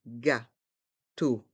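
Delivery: "Gâteau" in two separate beats; learner-paced, split "Gâteau"; syllable by syllable. Shown as a demonstration. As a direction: break "Gâteau" into gâ and teau. Teacher breaks word into syllables.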